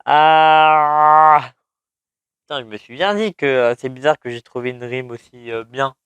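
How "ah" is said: frustrated yell. Real, not acted. Frustrated after finding the rhyme.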